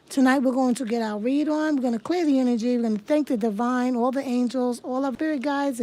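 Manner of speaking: loud, rousing call to the spirits; rally the viewers with a strong voice; energetic proclamation. Acted. Opening the live reading for viewers, loud and rousing.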